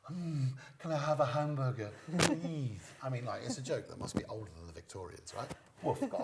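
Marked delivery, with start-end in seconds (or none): 0.0-2.8 louder, higher mock-innocent repeat; punchline repeat. Comic replays sketch punchline with a mock-puzzled repeat. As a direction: replay the order as if unheard. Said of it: "to which I reply".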